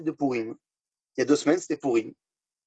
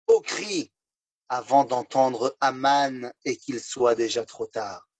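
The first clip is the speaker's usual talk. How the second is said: slow grave warning; much slower than usual. Acted. Rabbi explains gravely.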